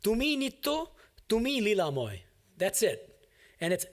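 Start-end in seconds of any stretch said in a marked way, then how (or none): 0.0-2.2 "Tumi nitto, tumi lilamoy" said in Bengali, higher and set apart, rising on the first "Tumi"; Bengali original. Shown as a demonstration. Teacher showing students how succinct the original Bengali line sounds, in a higher, set-apart reading. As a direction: recite "Tumi nitto, tumi lilamoy" as the crisp Bengali source text. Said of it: "Listen to the Bengali, how succinct it is".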